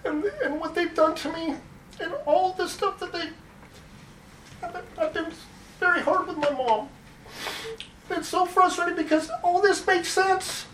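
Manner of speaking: pleading through rising emotion; emotional, near tears. Real, not acted. Public speaker at council meeting, pleading near tears. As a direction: voice pitched high and strained with upset. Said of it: "I'm just so frustrated".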